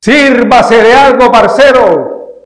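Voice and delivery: slow, loud hearty invitation; loud drinking buddy. Acted. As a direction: boom it out like a tipsy pal. Voiced drinking pal invites.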